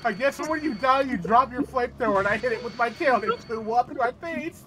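excited. Real, not acted. Excited recap of death.

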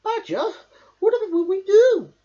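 high, loud pleading worry; high hedgehog near panic; anxious Hedgehog. A narrator's reading. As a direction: beg for help in a panic. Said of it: "Hedgehog gasped".

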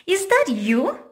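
realising it might be a friend; surprised, suspicious question. Acted. Square realises the trick.

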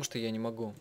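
usual speaking voice

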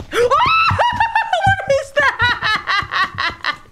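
high, loud, drawn-out scream laughing; terrified shriek. Real, not acted. Gamer shrieking at something unseen. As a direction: scream the question in terror. Monster appears.

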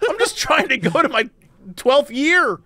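high, exasperated scolding; annoyed student rant. Pretend. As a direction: scold them like a fed-up classmate. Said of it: "I'd be like".

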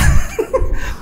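a quick laugh up high; short high laugh. Real, not acted. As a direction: chuckle up high at the joke. Laughing at own joke. (tiếng cười ngắn)

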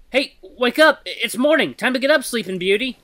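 calling out to rouse a sleeper; rousing call. Acted. A voice wakes the sleeper.

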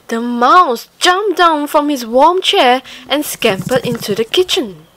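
ordinary delivery, nothing marked

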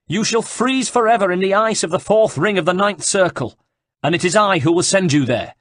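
high, loud vow of vengeance; menacing threat. Acted. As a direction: threaten him with damnation. Herald threatens Ezio.

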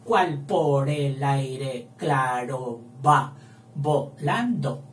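"¿Cuál por el aire claro va volando?" read slowly and evenly to count; slow verse counting; slow scansion. Shown as a demonstration. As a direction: count the line by speaking it slowly. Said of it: "porque tendrá once".

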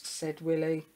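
ordinary delivery, nothing marked

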